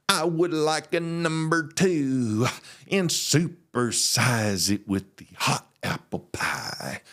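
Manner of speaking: very slow, drawn-out pastor voice; preacher drawl. Retold or imitated. Imagining his pastor ordering at McDonald's, slow preacher drawl. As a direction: preach the fast-food order, stretching every word. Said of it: "what I was expecting him to, to say".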